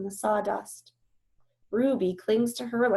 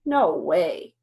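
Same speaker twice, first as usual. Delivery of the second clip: doubtful; 3 semitones lower, 6 dB louder than usual. Acted. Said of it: "still doubtful".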